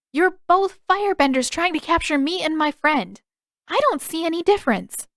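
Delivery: put-on a young girl challenging the prince; girl's voice. A narrator's reading.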